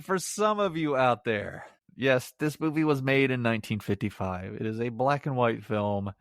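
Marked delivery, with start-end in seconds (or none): none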